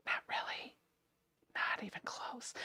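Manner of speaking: hushed, whispered aside; quiet whispered retraction; whispered confession. Real, not acted. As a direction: whisper it as a sheepish admission. Whispered aside undoing her joke.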